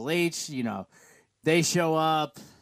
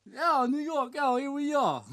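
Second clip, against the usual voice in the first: hammed-up New York accent, pitched higher; 10 semitones higher than usual. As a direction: play a playful New Yorker with a thick New York accent. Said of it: "your New York accent is actually".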